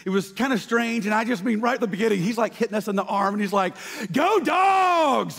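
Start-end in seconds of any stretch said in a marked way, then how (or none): 4.1-5.4 rowdy fan yell. Retold or imitated. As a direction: scream it like a drunk fan at a game. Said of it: "he's just screaming".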